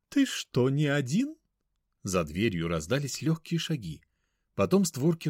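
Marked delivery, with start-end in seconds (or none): 0.1-1.4 high, slow, mannered lady. Acted. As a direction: call out in a lofty mannered tone. Mother speaks, same voice.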